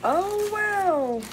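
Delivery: high, drawn-out wow; high excited gasp of delight. Real, not acted. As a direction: squeal at the new toy. New toy revealed.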